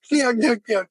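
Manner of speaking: high, mock Curly nyuk nyuk. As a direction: ham up a Three Stooges chuckle. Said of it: "like Curly from the Three Stooges".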